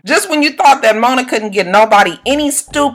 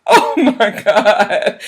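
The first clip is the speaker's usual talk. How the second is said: laughing; much slower than usual. Real, not acted. Laughing at the show.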